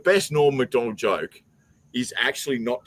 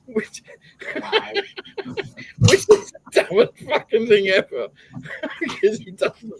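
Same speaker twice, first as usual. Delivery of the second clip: words squeezed out between real laughs; laughing while talking; 11 semitones higher than usual. Real, not acted. Mocking the joke to Patrick while laughing hard. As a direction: laugh through the comment. Laughing through his own comment.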